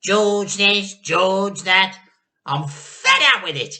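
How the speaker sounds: loud, jealous rant; Grandma shouting. A narrator's reading. Grandma shouts she is sick of George. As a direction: spit out the resentment. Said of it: "shouted Grandma".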